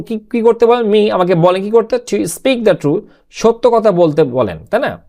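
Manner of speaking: ordinary talk, nothing marked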